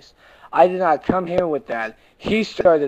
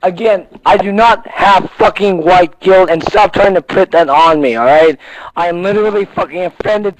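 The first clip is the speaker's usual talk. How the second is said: angry insistence; 6 dB louder than usual. Real, not acted. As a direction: hammer it out loudly, word by word. Angrily rebuts a repeated accusation.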